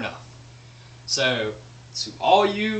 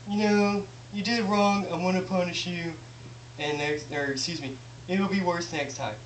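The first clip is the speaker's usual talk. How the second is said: calm, softer parental scolding; calm talking to kid; 3 semitones higher, 5 dB quieter than usual. Shown as a demonstration. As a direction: explain it gently to your child. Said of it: "that's normal emotional talking".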